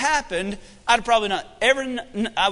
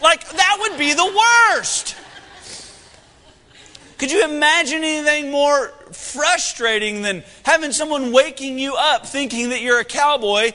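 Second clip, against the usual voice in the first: worked-up, pitched-up exclaiming; over-anxious; 4 semitones higher than usual. Real, not acted. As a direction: get carried away with mock-horrified agitation. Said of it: "A little over anxious there".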